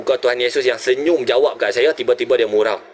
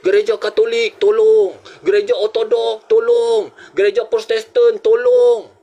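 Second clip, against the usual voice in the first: high chanted cries, louder on each plea; crowd crying for help; 9 semitones higher, 3 dB louder than usual. Retold or imitated. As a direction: imitate drowning people calling out in desperation. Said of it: "Saya dengar mereka berseru".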